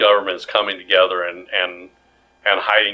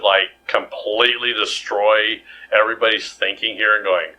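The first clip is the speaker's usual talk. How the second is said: ordinary talk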